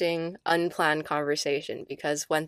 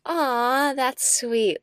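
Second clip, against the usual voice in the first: sleepy aw; 7 semitones higher, 4 dB louder, much slower than usual. Retold or imitated. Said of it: "And I was like".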